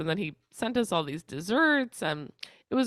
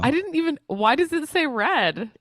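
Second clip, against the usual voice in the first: loud, high, slowed disbelief; can't believe the texts show read; surprised; 9 semitones higher, 7 dB louder than usual. Real, not acted. Surprised to learn about read receipts.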